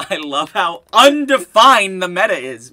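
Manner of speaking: loud, laughing mockery; laughing sarcasm. Real, not acted. Player mocking his friend's meta remark, laughing sarcasm. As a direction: push the sarcasm out through laughter.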